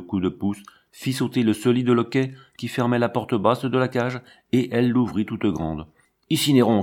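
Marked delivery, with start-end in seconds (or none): 6.3-6.8 called-out command. A narrator's reading. Zézette calls the lion out of its cage, called-out command. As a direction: call the lion over, louder. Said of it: "cria-t-elle".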